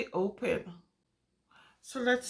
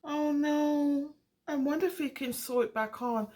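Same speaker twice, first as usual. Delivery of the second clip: ordinary delivery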